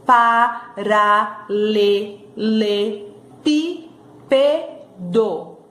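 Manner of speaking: pa-ra-le-le-pí-pe-do, very slow with gaps; syllable by syllable. Shown as a demonstration. Teacher sounds out the long word for pupils, slowly by syllables. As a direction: open the mouth on each of the seven beats of paralelepípedo. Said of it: "Tem sete sílabas".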